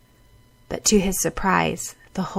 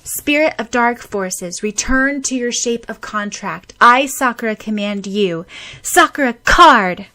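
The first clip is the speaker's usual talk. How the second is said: commanding shout; 4 semitones higher, 7 dB louder than usual. Acted. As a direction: call the spell out like a command. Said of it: "Sakura shouted".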